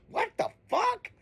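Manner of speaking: high, soft, stunned disbelief; shocked outburst. Retold or imitated. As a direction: replay your stunned reaction on the couch. Retold reaction watching TV.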